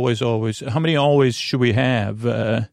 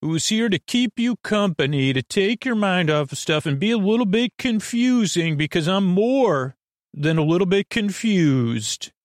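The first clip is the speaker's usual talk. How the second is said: big showman intro, higher and slow; booming kids show opener; 4 semitones higher than usual. Acted. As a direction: announce it like a circus ringmaster. Podcast intro announcer.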